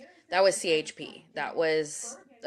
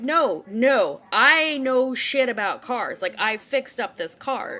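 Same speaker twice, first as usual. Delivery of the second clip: insistent protest; 4 semitones higher, 6 dB louder, slower than usual. Retold or imitated. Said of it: "I'm like".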